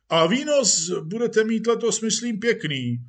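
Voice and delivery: high, loud, hearty chat; jovial police chief. Acted. Police chief predicts good takings, jovially. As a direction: make confident small talk as a patron.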